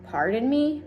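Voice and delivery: usual speaking voice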